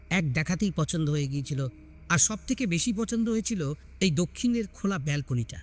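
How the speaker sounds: usual speaking voice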